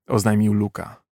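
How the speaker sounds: ordinary delivery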